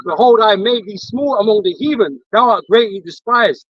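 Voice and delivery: slow, loud, solemn verse reading; scripture reading. Acted. Reading Obadiah to his co-host, slow and solemn. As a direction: proclaim the verse slowly like a preacher.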